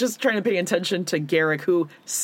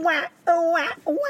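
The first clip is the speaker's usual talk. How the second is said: higher mock baby wail "wah"; baby crying; 9 semitones higher than usual. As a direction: imitate a tired swaddled baby crying. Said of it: "Like a baby would do".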